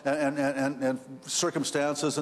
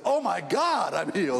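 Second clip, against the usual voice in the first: Oh my God pitched up, then I'm healed settling back; more natural, grounded cry of relief; a milder imitated exclamation; the real version; 3 semitones higher than usual. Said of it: "is what he said".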